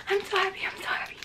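high joy fading to a hush; overjoyed. Real, not acted. Collector repeating herself over her dream pin, overjoyed squeak. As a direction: squeak it, running out of breath from happiness.

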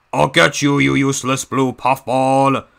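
a hint of a Russian heavy snarling; menacing taunt; Diesel 10 Russian accent. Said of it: "my Diesel ten voice impression".

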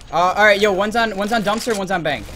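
loud, high rapid callouts; excited callouts. Real, not acted. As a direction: fire off the callouts loudly.

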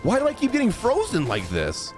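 ordinary talk

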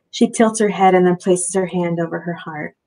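usual speaking voice

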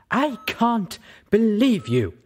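high, slow, loud outburst; nearly shouting. Acted. Velvet Remedy confronts Little Pip, a slow near shout. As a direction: let the disbelief burst out. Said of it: "she nearly shouted".

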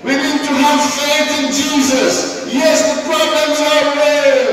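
high, loud, fervent preaching; a rising shout of faith over the music; full-force preaching. Acted. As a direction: preach it out to the whole church.

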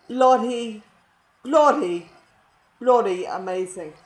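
loud, hammed-up British "bloody"; bad British accent. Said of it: "my bad British accent is really, really freaking bad".

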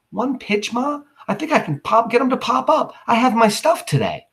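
put-on high, eager boy's protest; teen boy voice. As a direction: plead for the big moment. Boy begs for one pitch.

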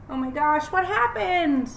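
lively rhythmic picture-book reading; reading to children. Acted. Teacher reading a rhyming book to her class, lively storytime voice. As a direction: read it bouncy for little kids.

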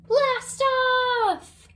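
high, loud launch cry; big excited countdown finish; excited blast off. Acted. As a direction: shout it as the rocket lifts off.

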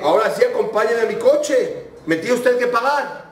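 high, urgent demand for payment; insistent demand. Retold or imitated. Driver demands his fare.

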